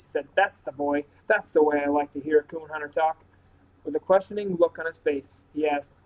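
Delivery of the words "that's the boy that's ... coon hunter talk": ordinary delivery, nothing marked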